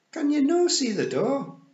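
very high, loud question; urgent Scots shout. Acted. Rob pressing Tiffany to find the door, urgent shout. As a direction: demand it in squawky Scots.

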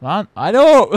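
sudden loud shout; loud yell. Real, not acted. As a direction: bark it at the attacker. Shouting at attacker in game. (yüksek sesle bağırarak)

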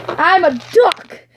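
high, slow, loud squeaky duck. Pretend. Toy duck introduces itself.

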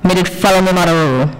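lightly voiced Jamaican patois, cheeky and quick; sassy Jamaican patois. Speaker voicing her inner monologue at work, sassy Jamaican patois. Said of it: "That was her speaking".

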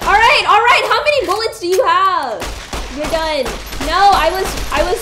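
loud, high frantic shouting; panicked yelling. Real, not acted. Player shouting in panic during a shootout, loud and high. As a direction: yell through the chaos of the fight.

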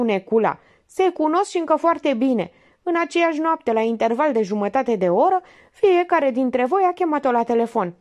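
high, pressing detective voice; Minerva interrogating. A narrator's reading. Minerva grills the suspect actor, high and pressing.

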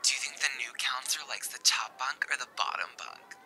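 whispered like a camper on a walkie-talkie; hushed secret whisper ending with over. Pretend. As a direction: kids whispering after lights out. Camp walkie-talkie whisper bit.

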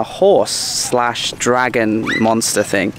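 ordinary talk, nothing marked

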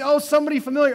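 light up at a familiar face; delighted recognition. Pretend. Churchgoer spotting a familiar stranger, delighted recognition.

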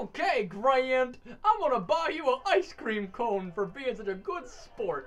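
loud, high, slow cheery voice; hammed-up excitement on demand; overly enthused. Hyper-enthused on request.

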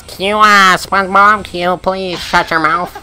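nasal grumpy cartoon squid; Squidward voice. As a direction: whine it like a cranky cartoon neighbour. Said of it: "he sounds like Squidward when he talks".

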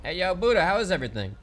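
loud call across the room to Buddha. Real, not acted. Calling out loudly to crewmate Buddha. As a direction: shout his name to get his attention.

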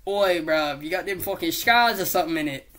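loud, quick mock British geezer; put-on British accent. Osborn asking Peter about scars, hammed-up British accent. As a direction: ham up a British "bruv" accent. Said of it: "doing accents to change it".

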